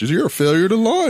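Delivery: high, loud mocking dad voice; stern dad. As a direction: jab it like a disappointed father. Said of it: "my dad was so afraid".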